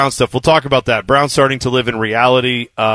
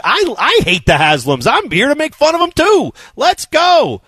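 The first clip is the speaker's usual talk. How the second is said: climbing to a high, eager cry; excited, pitch soaring by the end; fired-up; 12 semitones higher than usual. Real, not acted. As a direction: build into a rallying cheer. Answering critics with fired-up agreement.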